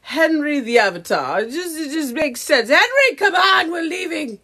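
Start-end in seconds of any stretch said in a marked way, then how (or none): 2.7-4.4 high, loud summons tipping into laughter; mock calling out. Colonizer avatar ordering Henry to leave, mock shout. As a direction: holler as a bossy snob.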